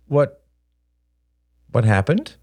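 hesitant, tentative question; cautious father's query; careful asking. A narrator's reading. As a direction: tread gently, with a pause. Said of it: "asked Robert carefully".

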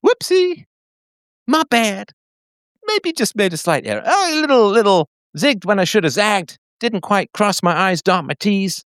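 high mocking falsetto confession; sing-song mock apology; mock woman's voice. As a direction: squeak it out like a sheepish woman.

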